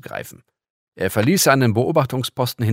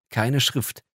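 ordinary delivery

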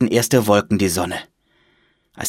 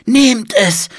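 put-on high, raised old man's plea; trembling old monk; 13 semitones higher, 6 dB louder than usual. A narrator's reading. Old monk holds out his book, high urging. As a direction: offer it urgently in a high old voice.

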